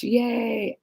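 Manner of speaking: ordinary talk, nothing marked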